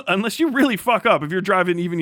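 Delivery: ordinary talk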